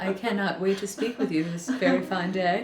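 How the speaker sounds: lightly voiced polite phone greeting; mock formal phone manner; customer service voice. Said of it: "My phone voice".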